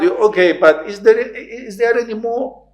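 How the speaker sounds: very high, loud plea; high desperate plea. Retold or imitated. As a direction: cheekily ask for someone else. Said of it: "faith is like that". (very high, loud plea)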